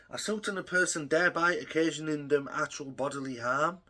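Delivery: normal talking voice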